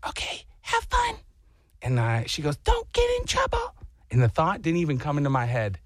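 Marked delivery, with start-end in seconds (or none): 0.1-1.2 high, light imitation of a wife; wife's send-off. Wife sends her husband off to wander, high airy imitation. 2.6-3.7 very high mock wife voice; wife's warning. Wife warns her wandering husband, high-pitched imitation.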